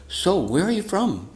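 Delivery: lightly voiced, high, slow friendly question; a hint of an eager devotee chatting up a stranger; friendly preacher. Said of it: "he said to this one girl".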